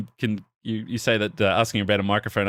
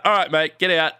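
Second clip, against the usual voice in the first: louder, higher bouncer order; stern order; 7 semitones higher, 5 dB louder than usual. Retold or imitated. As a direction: throw him out firmly. Security guard ordering exit.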